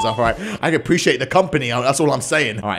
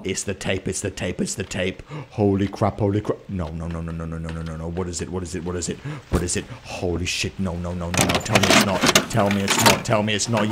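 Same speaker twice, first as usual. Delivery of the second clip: much softer, low frightened repeating; hushed dread; 9 semitones lower, 6 dB quieter than usual. Real, not acted. Streamer watching a creepy tape alone, hushed fearful repeating. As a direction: murmur it under your breath in growing fear.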